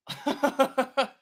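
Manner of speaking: loud high laugh. Real, not acted. Streamer laughing at a viewer, loud high laugh.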